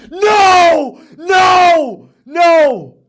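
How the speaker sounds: high, loud despairing yell; anguished shout. Real, not acted. Streamer seeing his pick lose, anguished shouting no. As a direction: howl at the lost pick.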